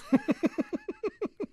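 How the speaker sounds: very high amused chuckle; a quick giggle at your own joke; high laugh. Acted. Bard laughs at his sneeze joke, high chuckle.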